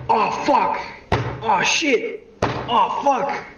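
high yelps of pain at every bump. Retold or imitated. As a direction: cry out sharply each time he gets thrown. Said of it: "and he's like".

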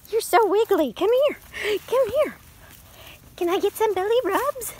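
high cooing at the dog. Real, not acted. Owner coaxes her wiggly dog for belly rubs, high baby talk. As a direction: coo and coax him for belly rubs.

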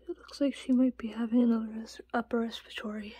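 soft worried whisper. Real, not acted. Owner whispering about her sick rat. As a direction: whisper so as not to scare her.

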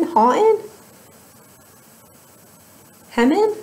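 rising, unsure of the word; puzzled questioning. Real, not acted. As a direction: test the phrase doubtfully.

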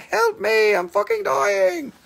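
high mock scream for help, peacock as a woman in danger; screaming lady. Peacock call compared to a lady screaming bloody murder, high mock shriek. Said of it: "like a screaming, bloody murder lady".

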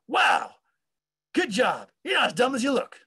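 hammed-up impression of an older colleague. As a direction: holler backhanded praise like an old-timer. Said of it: "And he said".

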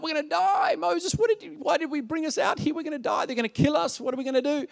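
very high-pitched mock panic; hammed-up panicked protest; freaking out. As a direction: play a whole crowd losing its nerve. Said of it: "the Israelites are freaking out".

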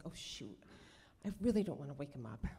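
very soft, low near-whisper; hushed dismay. Retold or imitated. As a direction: keep it down beside a sleeper. Man asleep beside her.

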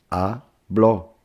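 habló split into "ha", pause, "BLÓ"; syllable by syllable. Shown as a demonstration. Teacher dividing habló for students, syllable by syllable. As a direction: say habló in two separate syllables. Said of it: "se divide así".

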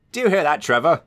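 a glad cry to the engine; cried out. A narrator's reading. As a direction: call out the good news. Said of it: "cried Jem".

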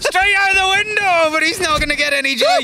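high, urgent commentary at full pitch; frantic play-by-play. Real, not acted. Caster following a player's desperate dash, frantic play-by-play. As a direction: call the escape with mounting excitement.

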